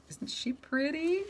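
cooing at the cat. Real, not acted. Owner admires her cat to viewers, high slow coo. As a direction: coo it like to a pet.

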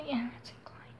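whispered to herself; a breathy private whisper while concentrating; whispered aside. Real, not acted. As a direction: murmur it under your breath to yourself.

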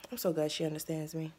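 ordinary delivery

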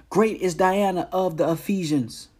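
loud, high cry of a crowd. A narrator's reading. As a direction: proclaim it loudly, pitched well up. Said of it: "and cried out".

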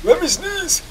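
very high comic pleading; squeaky plea. Pretend. Player with a sneeze that won't come, squeaky plea. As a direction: beg in a squeal.

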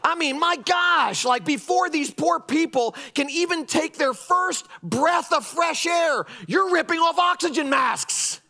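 fired-up complaint; exasperated, heated. Real, not acted. Pastor railing against critics, exasperated. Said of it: "Drives me nuts, can you tell?".

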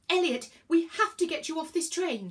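high, loud urgent plea; screamed. A narrator's reading. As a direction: shout it desperately, pitched high. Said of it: "screamed Virgo".

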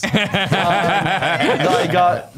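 cracking up mid-sentence; words stretched by laughter; laughing. Real, not acted. Laughing at friend's joke.

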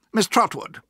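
slightly testy; a little cross. A narrator's reading. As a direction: address her with a touch of crossness. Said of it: "he said a little crossly".

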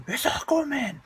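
high shouted surprise; excited shout. Pretend. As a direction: yell it in shock.

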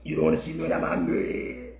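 very low, soft monster-like threat; angry warning. Acted. Man warning others once he gets mad, low menace. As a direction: drop far down into a quiet menacing growl. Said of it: "After me get mad".

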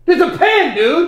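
high, loud protest; exasperated yell. Real, not acted. Player correcting his coach about a pen, exasperated yell. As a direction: burst out in exasperation. Angry outburst over mistaken pen.

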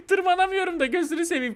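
high voice through laughter; laughing. Real, not acted. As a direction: talk while cracking up. Fails to climb, cracks up.

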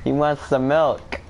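slow, drawn-out words; meme quote. Shown as a demonstration. Brother coaching a meme line, slow meme quote. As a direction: stretch each word of the meme line. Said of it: "Say it like that".

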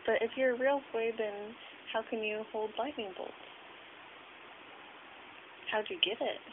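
ordinary talk, nothing marked

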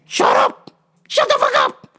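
very high-pitched mock-furious yell; comic high outburst; exasperated yell. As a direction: squawk it at the noisy neighbours. Imagined yelling at chatty ladies.

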